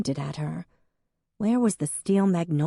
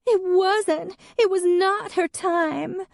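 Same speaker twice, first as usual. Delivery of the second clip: high, slow and louder, wounded; tearful insistence; 4 dB louder, slower than usual. Acted. Hadley correcting Emmeline, high and tearful. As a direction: plead it through tears.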